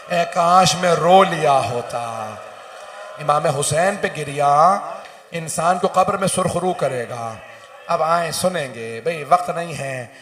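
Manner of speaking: loud, high, slowed lament; full-force majlis preaching. Acted. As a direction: move the mourners to weep. Preaching grief to mourners. (ज़ोरदार, धीमा मातमी बयान)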